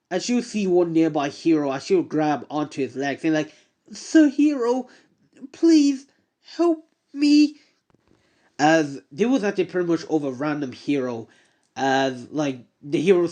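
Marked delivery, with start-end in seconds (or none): 3.9-7.6 high, loud begging; desperate pleading. Acted. Woman clings to the hero begging for help, high desperate plea. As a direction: plead as if your life depends on it.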